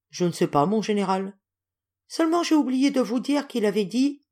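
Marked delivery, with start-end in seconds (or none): none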